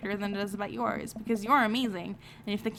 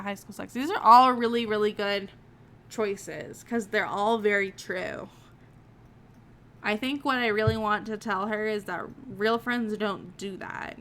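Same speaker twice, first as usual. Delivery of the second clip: normal talking voice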